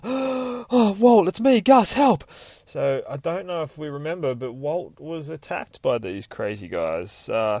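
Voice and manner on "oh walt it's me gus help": high, desperate begging voice; frightened plea. Acted. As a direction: plead with your friend, terrified. Gus begs Walt for help.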